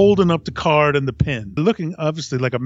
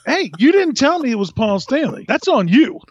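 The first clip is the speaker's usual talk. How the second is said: excited defense; 8 semitones higher than usual. Retold or imitated. Defending himself to Glenn.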